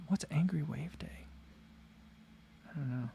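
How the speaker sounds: puzzled whisper. Real, not acted. Reading chat, puzzled about it.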